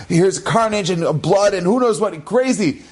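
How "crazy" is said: ordinary delivery, nothing marked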